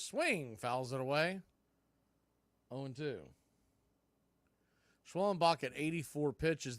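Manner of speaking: normal talking voice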